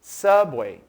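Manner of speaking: subway said the English way, for students to repeat. Shown as a demonstration. Teacher models subway for students to repeat, in English pronunciation. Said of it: "repita comigo".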